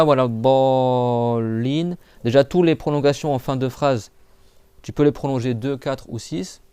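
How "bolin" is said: BOLIN with the o drawn out very long; prolonged vowels. Shown as a demonstration. Teacher shows pupils the Quranic prolongations, long-held vowels. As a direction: stretch both vowels of bolin, slowly. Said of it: "tu prolonges deux temps".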